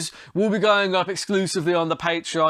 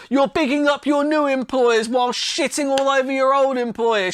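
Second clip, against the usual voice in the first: sarcastic mockery; 9 semitones higher, 4 dB louder than usual. Vlogger mocks the fighter's new allegiance, high sarcastic put-on voice. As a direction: pile on the mock shock and sarcasm.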